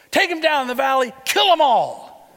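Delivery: loud, rising, high shout, slowing on kill 'em all; fierce command. Acted. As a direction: drive the order home. Elijah orders the killing.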